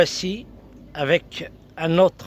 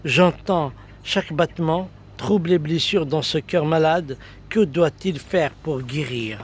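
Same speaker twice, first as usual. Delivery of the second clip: higher-pitched, flowing declamation; poetry recital; 5 semitones higher than usual. Acted. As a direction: recite the verse with lifted voice, easing down at the end. Recites a poem.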